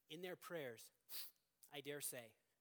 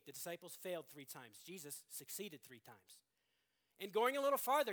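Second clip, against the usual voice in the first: ordinary delivery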